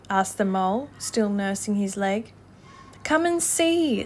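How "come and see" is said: high, drawn-out invitation; delighted. A narrator's reading. Rat inviting Mole to his find, delighted. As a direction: call it out slowly with delight. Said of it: "said the delighted rat".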